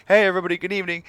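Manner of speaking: high, loud bright "Hey, everybody"; showman host greeting. Acted. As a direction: boom out a humble streamer's welcome. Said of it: "a humble video game streamer that's just like".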